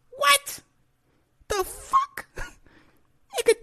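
very high disbelieving laugh-shriek; can't believe what he saw; squeaky shocked laugh; shocked squeal. Real, not acted.

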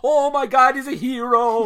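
high, loud, ghostly mock-Irish wonder; bad Irish accent. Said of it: "it's an impression of a ghost".

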